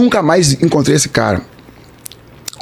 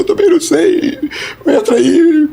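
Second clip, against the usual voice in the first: very high mock-crying voice; tearful; 12 semitones higher, slower than usual. Stranger admits he doesn't know either, tearful. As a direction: imitate a weeping man, half laughing. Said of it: "Mas chorando, chorando, os dois chorando".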